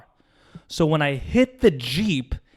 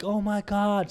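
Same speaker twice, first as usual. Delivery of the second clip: imitated crying child; 8 semitones higher, slower than usual. Child seeing the woman under the car, imitated wail. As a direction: imitate a distraught cry at a contained volume. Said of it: "then she begins to cry".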